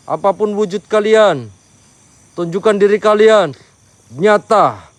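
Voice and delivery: loud commanding invocation; spirit summoning. Acted. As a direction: demand the spirits show themselves.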